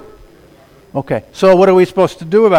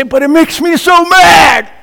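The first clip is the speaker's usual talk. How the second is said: high, loud, slow mock shout; mock angry churchgoer; 10 semitones higher, 5 dB louder than usual. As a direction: ham up an indignant listener. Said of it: "You say".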